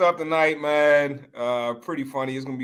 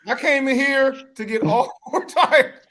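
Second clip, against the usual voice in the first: high, loud, slow sarcastic yes; exasperated mock customer; 9 semitones higher, 6 dB louder, slower than usual. Playing himself answering the salesman, loud high sarcastic. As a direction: lay the sarcasm on, laughing through the end.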